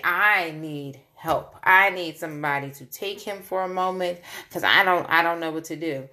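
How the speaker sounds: ordinary delivery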